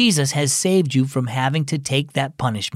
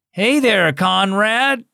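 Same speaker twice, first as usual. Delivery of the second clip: put-on slurred drunk voice; 6 semitones higher, slower than usual. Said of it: "said in a slurred voice".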